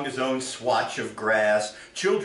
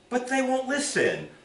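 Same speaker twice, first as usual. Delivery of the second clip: higher, anxious protest; a lightly voiced worried plea; pleading; 13 semitones higher than usual. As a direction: Moses objecting to the bush. Moses voiced answering bush.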